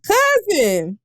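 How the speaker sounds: high, drawn-out happy call; excited hail. Real, not acted. Host spotting a cousin among the commenters, excited call. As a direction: call out to a relative in delight.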